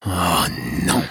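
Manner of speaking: mild moan of dismay. A narrator's reading. Prior hearing the Vikings have come, moaning. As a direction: groan it out in dread. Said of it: "gémit le prieur Ulfric".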